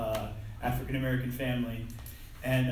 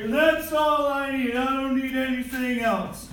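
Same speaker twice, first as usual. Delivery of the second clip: dopey movie character; 12 semitones higher, 10 dB louder than usual. Retold or imitated. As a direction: stretch every word like a clueless man-child. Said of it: "Here he is, his pants down".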